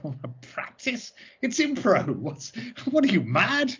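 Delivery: high-pitched incredulous protest; mock outrage, voice jumping up. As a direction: retell it as an appalled, scoffing objection. Retold scoffing at practising improv.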